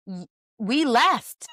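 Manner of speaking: slow, flat airline clerk; a hint of a deadpan gate agent. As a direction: mimic the bored gate agent dragging it out. Gate agent voiced in story.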